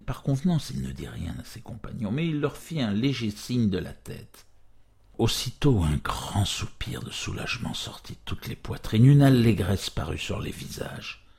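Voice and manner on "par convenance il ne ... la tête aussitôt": usual speaking voice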